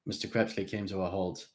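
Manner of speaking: usual speaking voice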